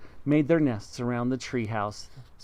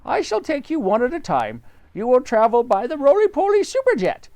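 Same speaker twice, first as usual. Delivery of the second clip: high bird voice; roly-poly bird; 11 semitones higher, 6 dB louder than usual. Acted. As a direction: chirp it like a friendly bird.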